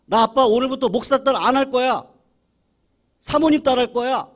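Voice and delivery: loud, high sulky declaration; angry child's retort. Retold or imitated. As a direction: shout back like a defiant kid. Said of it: "이 딸이 화가 나가지고".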